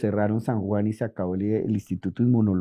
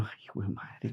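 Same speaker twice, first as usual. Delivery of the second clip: muttered to self; 2 semitones lower, 15 dB quieter than usual. Retold or imitated. Stunned reaction to the chemist's credentials.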